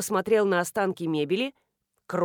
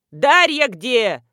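very loud, drawn-out shout; bawling; 3 semitones higher, 9 dB louder, much slower than usual. A narrator's reading. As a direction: bellow the question slowly. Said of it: "заголосил Дегтярев".

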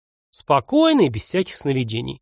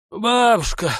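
higher, restrained call; effortful, drawn call; 7 semitones higher, slower than usual. A narrator's reading. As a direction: call to grandmother while collecting yourself. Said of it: "позвал Матвей".

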